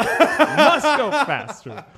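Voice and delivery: high, slow, loud laughing movie line; Jurassic Park quote. Retold or imitated. Fleeing a bouncer, quoting the movie, slow and loud. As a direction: ham up the famous line while laughing. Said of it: "a Jurassic Park situation".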